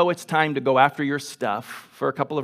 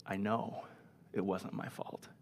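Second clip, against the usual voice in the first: much softer, tight and restrained; tense hush; 7 semitones lower, 17 dB quieter than usual. Retold or imitated. Young man pushing back at his mentor, hushed and tense. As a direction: hold it in, barely above a breath. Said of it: "Damon got a little bit more intense".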